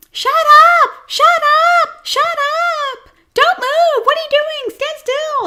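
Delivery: piercing, very high screeched commands; hammed-up screeching groomer scolding dogs; yelling at dogs. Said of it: "this girl would just yell at dogs all day long".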